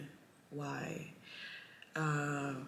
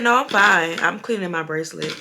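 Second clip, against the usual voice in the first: louder repeat; 14 dB louder than usual. Real, not acted. Repeating herself after her friend says huh, louder. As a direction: repeat it louder so she hears.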